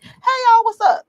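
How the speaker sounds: high, loud acted hello; casual group greeting. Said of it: "bunch of people that look like me and I'm like".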